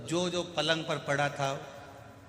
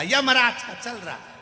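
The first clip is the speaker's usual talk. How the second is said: angry snap; 9 semitones higher, 5 dB louder than usual. Retold or imitated. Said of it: "अब इसको आ गई गुस्सा".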